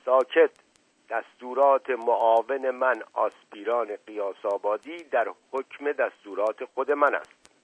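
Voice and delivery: ordinary delivery, nothing marked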